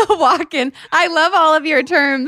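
laughing the word "Walk-in." out high. Real, not acted. Guest amused by the host's term, laughing. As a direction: laugh while repeating his term.

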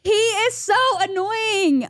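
high, drawn-out exasperation; exasperated. Real, not acted. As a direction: stretch it out slowly in a high, fed-up voice. Venting about the man retold.

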